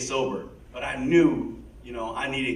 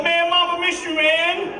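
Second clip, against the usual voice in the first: playing the brother; 7 dB louder than usual. Playing along as fake half brother.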